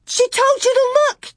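put-on very high, frantic child's scream. A narrator's reading. Carrie demanding Laura search for Grace, screaming. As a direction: scream at her to act. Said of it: "Carrie screamed".

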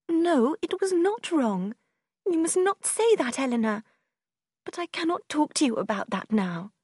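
high, protesting voice that sinks at the end; distressed sister. A narrator's reading. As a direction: object hotly, then withdraw.

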